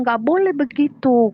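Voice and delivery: high, loud imitated reproof; admonishing. Teller corrects in-law's behaviour, admonishing.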